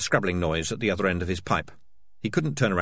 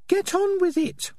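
very high, drawn-out falsetto order; squeaky, exasperated prodding; impatient Peaches; 3 dB louder, slower than usual. Acted. Peaches impatient with stalling Morris.

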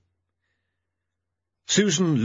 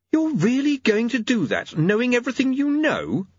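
incredulous; 14 semitones higher than usual. Acted. Susan's shocked reply.

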